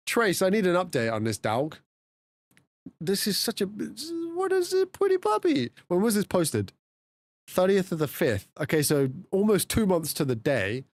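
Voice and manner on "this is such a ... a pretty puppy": high, slow cooing puppy talk. Real, not acted. Streamer cooing at a puppy photo, high baby talk. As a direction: coo it at the puppy.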